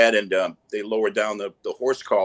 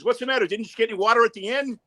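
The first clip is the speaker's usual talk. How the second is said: high, quick hammed-up salesman; mock salesman voice; 12 semitones higher, faster than usual. Tie salesman questioning the crawler, high hammed-up voice. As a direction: play the chirpy tie seller, much higher.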